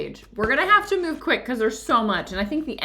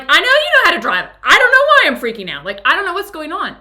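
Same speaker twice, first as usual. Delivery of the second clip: retold frantic answer, higher, louder and fast; panicked reply; 8 semitones higher, 11 dB louder, faster than usual. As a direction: defend yourself mid-panic attack. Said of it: "I'm like".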